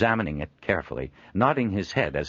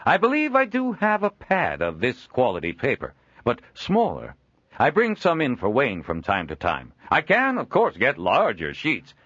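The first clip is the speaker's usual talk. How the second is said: raised, louder hearty merchant voice; a jovial trader chuckling at his own likeness; Joel, amused trader; 7 semitones higher, 5 dB louder than usual. Acted. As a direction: genial old trader voice, pitched up. Joel offers paper.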